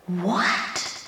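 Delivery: a low, soft, incredulous what; stunned disbelief. Real, not acted. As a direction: let it drop out quietly in shock. Reacting in disbelief to scripture.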